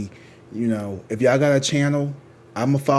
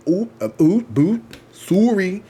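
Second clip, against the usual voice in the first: higher, louder mock Canadian accent, slowed on boot; playfully imitating a Canadian tarot reader's accent; 9 semitones higher, 6 dB louder than usual. Vlogger imitating a Canadian YouTuber in a Canadian accent. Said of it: "I think she may be Canadian".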